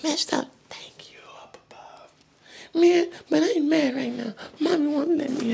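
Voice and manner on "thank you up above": breathy whispered thanks; hushed grateful whisper upward. Acted. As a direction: whisper it like a secret to the sky. Thanking magic powers secretly.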